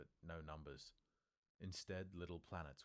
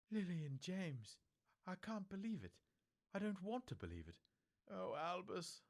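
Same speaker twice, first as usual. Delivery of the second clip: put-on high, stern old witch voice, upset; a shaken elderly teacher near tears; McGonagall, distressed; 5 dB louder than usual. A narrator's reading. McGonagall tells Dumbledore of the Potters, high and distressed.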